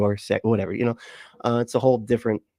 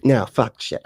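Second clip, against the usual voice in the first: ordinary delivery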